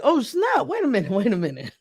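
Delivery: high-pitched mock panic, settling at the end; retold sudden alarm. Traveler realizes they forgot being scared of flying, sudden alarm. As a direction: act out the moment it suddenly dawns.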